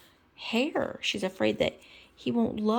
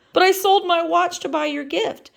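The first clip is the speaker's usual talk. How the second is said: Kermit voice; 12 semitones higher, 7 dB louder than usual. Acted.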